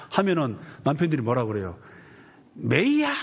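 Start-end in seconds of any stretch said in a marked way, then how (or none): 2.6-3.1 high, loud startled husband yelling; mock a husband who can't believe it; husband's shocked cry. 남편이 놀라 소리침. (깜짝 놀란 남편의 높고 큰 외침)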